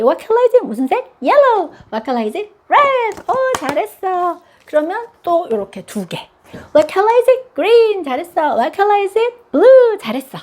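high, bright teacher-mom voice; high cheerful quiz. Shown as a demonstration. Mom quizzing toddler on colors, high and cheerful. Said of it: "demo mom-child quiz".

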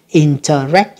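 Interact, rising onto a stressed last syllable. Shown as a demonstration. Teacher showing students the stress, last-syllable stress on Interact. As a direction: save the weight for the end of Interact. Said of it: "nhấn âm cuối".

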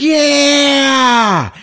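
loud, high cheer of the crowd; mock crowd cheer. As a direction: whoop like a festival crowd. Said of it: "everybody's like".

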